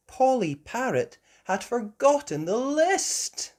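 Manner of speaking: usual speaking voice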